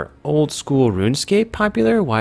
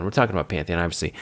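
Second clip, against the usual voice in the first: "Pantheon" lower and softer; low, matter-of-fact correction on "Pantheon"; lower repeat; 5 semitones lower, 6 dB quieter, much faster than usual. Real, not acted. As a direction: restate "Pantheon" in a dropped, quieter voice.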